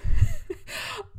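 squealing laugh breaking out high; real giggle while worked up; high burst of laughter. Real, not acted. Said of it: "you can tell probably how worked up".

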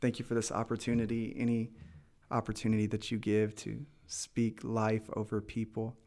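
pastor's blessing prayer, growing stronger; fervent prayer. Acted. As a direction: pray over the listeners, rising in fervour. Pastor prays over listeners.

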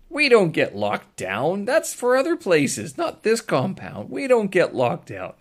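3 insist it can't be true, spelling each word out; 1 indignant disbelief. Retold or imitated. 4 protesting that lockdowns are for other places, loud slow disbelief.

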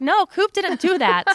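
high, loud retold protest; mock scolding. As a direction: indignantly stick up for the dog, half in fun. Retold scolding of husband, defending dog.

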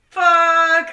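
very loud, high drawn-out curse; acted exasperation at full volume; retold dismay. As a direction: belt it out as an exasperated outburst. Retold panic at being caught.

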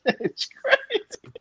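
high slow laughing words. Real, not acted. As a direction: crack up while saying it. Laughing at Toretto head.

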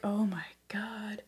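breathy whispered dismay; exasperated whisper. Retold or imitated. Reacting to brother's genius idea, whispered. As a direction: whisper it in disbelief.

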